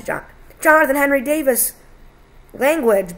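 high, loud full-name telling-off; scolding exclamation. A narrator's reading. Father scolds son for swearing, sharp exclamation. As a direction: exclaim it like a parent catching a swear word. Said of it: "Bill exclaimed".

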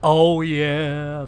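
usual speaking voice

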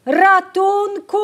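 high, loud, drawn-out scream; scream for help. A narrator's reading. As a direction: scream for help, lost in the corn. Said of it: "wrzasnęła Madzia".

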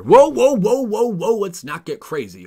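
high, loud whoa whoa; mock alarm. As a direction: pull yourself back in a panic. Reining himself in.

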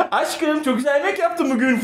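high, cooing voice full of laughter; mock sweet lover voice. Mimicking Can cooing at his partner at home, high and sugary. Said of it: "Can'ın evinde garip huyları var".

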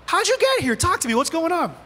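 high-pitched, urgent coaxing; God questioning Elijah. Acted. God asks Elijah to talk, high, insistent questioning. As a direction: coax an answer out, pitch well up. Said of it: "God's saying".

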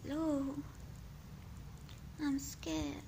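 high, crying, frightened small voice; frightened child telling Lou about the beatings; scared child voice. Acted. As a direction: sob it like a hurt child. I'm scared.

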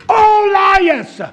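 loud, high cry of liars; shouted denunciation. Real, not acted. Preacher to congregation, shouting all liars. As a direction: hurl it at them.

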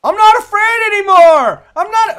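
high, loud mock-brave yelling into the dark; hammed-up defiant shout at unseen attackers, drawn out; Home Alone shout. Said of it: "Home Alone reference".

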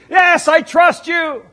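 high, loud, slow and earnest assurance; a desperate man promising God he has faith; eager yes. Retold or imitated. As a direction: insist hard that you believe.

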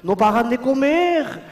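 a touch of a panicky French officer; frightened French general. Acted. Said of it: "Decía el general".

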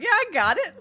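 imitating Brian. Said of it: "and he's like".

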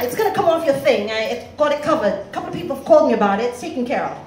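put-on quick, offhand British-accented wrap-up. British debtor ending the collection call, British accent. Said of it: "he's got a British accent".

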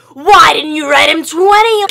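slow, high, full-volume yell; inner scream. Retold or imitated. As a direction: scream what you couldn't say aloud. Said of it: "On the inside, I'm like".

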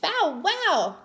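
put-on high, excited toddler bark; high-pitched baby cry of glee. A narrator's reading. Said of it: "cried Baby Louie".

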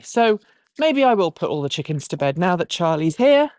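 ordinary talk

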